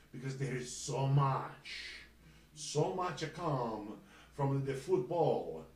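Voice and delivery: put-on slow, lilting Italian accent. Host hyping the football news in Italian accent. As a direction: drift into a hammy Italian accent. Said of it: "slipped into my Italian accent".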